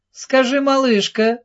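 put-on lady sweet-talking the child; smiling. A narrator's reading. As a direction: coax the girl with a smile. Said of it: "с улыбкой обратилась".

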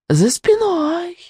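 very high, slow, held note; singsong witch. A narrator's reading. Witch taunts from another part of the dungeon, singsong. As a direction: sing it out eerily, high and stretched. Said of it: "пропела страшная соседка".

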